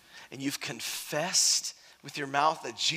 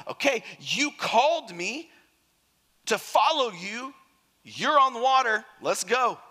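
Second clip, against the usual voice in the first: climbing higher and louder with eager resolve; eager Peter; 7 semitones higher, 4 dB louder than usual. Acted. Excited call to step onto the water.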